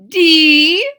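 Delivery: high, loud teacher letter; teacher calls letter. Acted. Teacher calls a letter, high loud. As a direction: call it out crisply.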